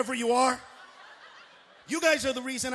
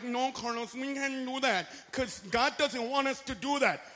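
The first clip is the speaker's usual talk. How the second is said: softer prim objection; mock pious whine; 6 dB quieter than usual. Churchgoers objecting to Carlos, a soft prim whine.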